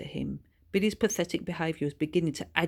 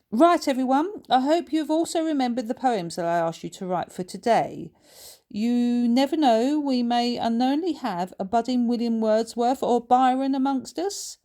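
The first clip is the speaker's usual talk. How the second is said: high and louder, rousing the class; teacher hoping for a budding poet, higher and louder; enthusiastic teacher; 7 semitones higher, 6 dB louder than usual. A narrator's reading. Missus Rowlands voiced.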